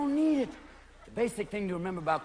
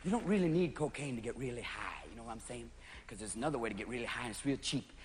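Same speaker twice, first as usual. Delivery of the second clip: much softer, low confiding hush; 9 semitones lower, 10 dB quieter than usual. Real, not acted. Comic confiding to the crowd, soft and low. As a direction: lean in and murmur.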